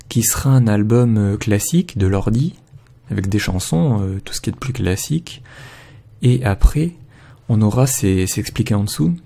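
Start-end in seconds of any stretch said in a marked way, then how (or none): none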